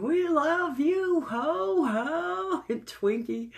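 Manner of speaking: high, stretched-out fan scream; retold fan screaming. Screaming at the ho-ho guy at the Kroger promo, high and drawn out. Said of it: "And we're screaming".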